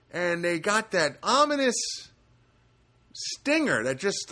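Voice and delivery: ordinary talk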